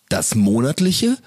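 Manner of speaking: slow, drawn-out jingle announcement; improvisierter Jingle. Shown as a demonstration. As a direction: improvise a show jingle, stretching the words. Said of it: "da brauchen wir einen Jingle für". (gedehnte Jingle-Ansage)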